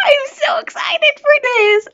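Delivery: high, loud, squealing with joy; excited squeal. Real, not acted. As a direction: scream it out with pure excitement. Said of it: "screaming my head off with excitement".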